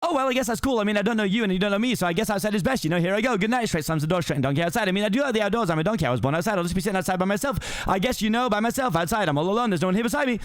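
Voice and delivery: rapid-fire script read; as fast as possible. Shown as a demonstration. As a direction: race through it without stopping for breath. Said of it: "as fast as I can say it".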